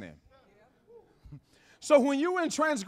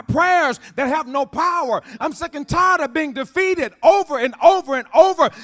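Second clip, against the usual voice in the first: loud, building sermon exhortation; preaching at full force; 9 dB louder than usual. Acted. Pastor urging the church to get fed up, full-force preaching. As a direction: preach it hard to the congregation.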